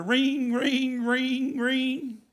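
high sing-song rings, one per beat; phone ringing. Retold or imitated. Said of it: "Next thing you know".

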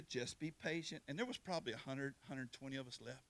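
low, soft retelling of a guard's promise; hushed storytelling. Retold or imitated. Guard promises the waiting convicts they go home, hushed. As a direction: drop low and quiet, measured reassurance.

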